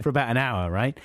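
ordinary delivery

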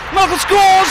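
high, loud goal yell; goal shout. Acted. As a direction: roar the goal. Goal scored in commentary.

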